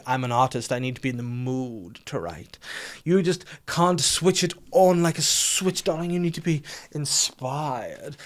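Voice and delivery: slow, high, airy artist voice; whimsical artist. Retold or imitated. Husband to wife, posing as the whimsical artist, drawn out. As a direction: play the precious creative, drawn out. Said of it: "I like to be like".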